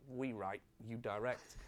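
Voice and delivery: mock-mime the writers' retort, barely voiced; mimed reply. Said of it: "they would kinda do a mime and say".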